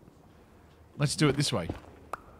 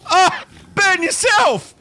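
loud, high, drawn-out battle cry; higher and louder, slowed shout; gleeful shout; 12 semitones higher, 6 dB louder, slower than usual. Real, not acted. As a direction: yell it while torching them. Setting enemy on fire.

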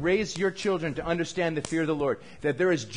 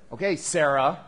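normal talking voice

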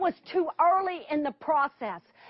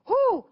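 a big relieved exhale, pitched up high; loud nervous whew; 9 semitones higher, 6 dB louder than usual. Retold or imitated. Telling her friend's silence broke, a loud whew. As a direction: blow out a loud whew of nerves. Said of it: "I said".

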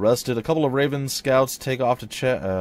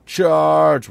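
loud, raised war cry; battle cry; 7 semitones higher, 10 dB louder than usual. Acted. Leader sending fighters at the bandits, battle cry. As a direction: shout the order to attack.

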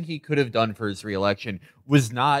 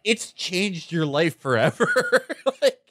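higher, mocking gloat; mocking taunt; 7 semitones higher than usual. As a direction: rub it in with glee.

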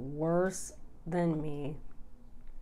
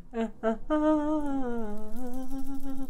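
tearful laugh; 7 semitones higher than usual. Real, not acted. Laughing off her own tears.

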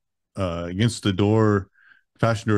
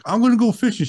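much higher, playful insisting; stubborn insistence; 14 semitones higher, 4 dB louder than usual. Retold or imitated. Insisting to Amanda.

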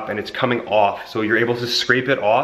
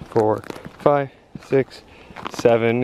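usual speaking voice

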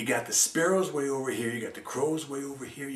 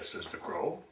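ordinary delivery, nothing marked

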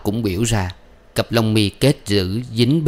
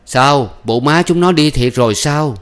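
higher, astonished neighbour; shocked question; 6 semitones higher, 4 dB louder than usual. A narrator's reading. Neighbour asking if the mother really left, shocked. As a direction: ask in raised-voice disbelief.

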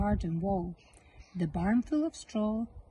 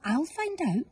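light, higher little ladybird; chirpy bug eager to help; lightly voiced ladybird; 7 semitones higher than usual. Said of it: "said the ladybird".

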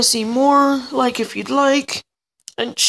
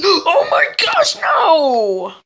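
high, loud wail of alarm; drawn-out cry, starting very high and dropping; panicked scream; 4 semitones higher, 5 dB louder, much slower than usual. Real, not acted. Gamer being caught in game, panicked scream.

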